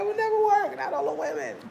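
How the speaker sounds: high, squeaky mock protest; mock incredulity. Imagining this in the States, high mock incredulity.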